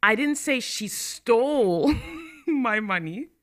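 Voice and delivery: stole pushed, slow; stress on STOLE. Shown as a demonstration. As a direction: hit the word stole. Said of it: "I considered it borrowed".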